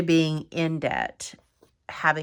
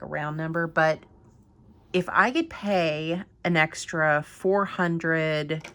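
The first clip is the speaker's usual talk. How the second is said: usual speaking voice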